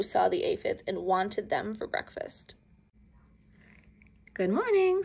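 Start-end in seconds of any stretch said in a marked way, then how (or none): 4.3-5.0 bright high friendly greeting; cheerful little bug voice; friendly ladybug. A narrator's reading. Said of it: "said the friendly ladybug".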